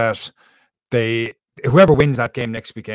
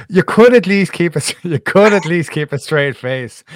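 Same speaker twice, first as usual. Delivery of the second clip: laughing; 6 semitones higher, 7 dB louder than usual. Real, not acted. Host laughing at guest's claim.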